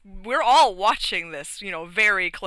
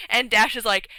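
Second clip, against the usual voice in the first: ordinary talk, nothing marked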